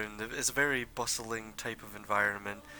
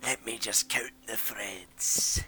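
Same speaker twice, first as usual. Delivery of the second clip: rough smoker's voice, slow and hushed; 4 dB louder, slower than usual. Acted. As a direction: mutter it like a chain-smoking old merchant. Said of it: "very smoker voice type deal".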